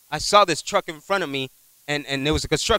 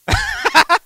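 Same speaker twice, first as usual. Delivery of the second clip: high burst of laughter; 4 dB louder than usual. Real, not acted. Pastor's joke lands.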